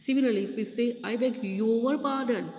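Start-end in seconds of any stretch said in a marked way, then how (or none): 1.1-2.5 challenging emphasis on the word "your"; stress on YOUR. Shown as a demonstration. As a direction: lean hard on "your", as if challenging. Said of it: "we are speaking in a different tone".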